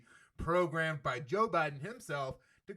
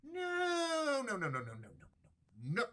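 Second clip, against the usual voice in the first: long, high wailed "no"; mock denial; 7 semitones higher, slower than usual. Host ruling out overdose death for soldiers, mock denial. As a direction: wail the no in mock horror.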